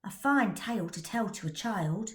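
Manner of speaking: ordinary delivery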